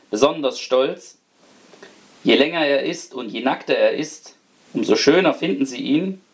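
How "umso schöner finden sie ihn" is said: usual speaking voice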